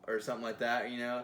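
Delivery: usual speaking voice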